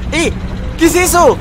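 loud, very high exclamations; astonished high-pitched yelling; startled excitement. Real, not acted. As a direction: cry out as they suddenly appear. Startled reaction to new arrivals.

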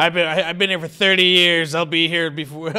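hammed-up territorial boast; indignant regular. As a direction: hold forth like an offended bar regular. Acts out heckling bar regular.

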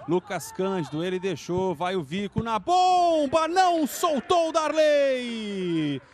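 ordinary talk

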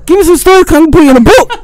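high, shouted request; acted loud pestering. Podcaster re-enacting asking lifers for stories, loud high pestering. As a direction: call it out as an eager demand.

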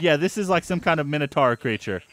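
ordinary talk

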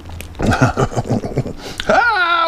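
squealing laugh; high squealing laugh. Real, not acted. After getting burned.

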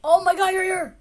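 loud, high happy cry of relief; joyful reunion. Real, not acted. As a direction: shout it out, thrilled to find him. Finding friend in game.

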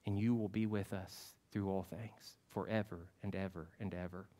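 soft prayer voice fading away; closing prayer. Acted. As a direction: pray gently and let it fade.

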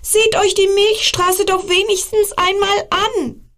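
put-on high child voice pleading on; star child calling. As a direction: keep begging the comets in a small voice. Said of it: "rief der einsame Stern".

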